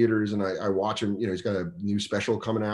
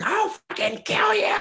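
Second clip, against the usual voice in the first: high mock furious shout; furious heckler; 3 dB louder than usual. Comic imitates angry Philly fans yelling at Burr, high mock shout. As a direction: scream threats like an enraged fan. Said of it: "half the crowd be like".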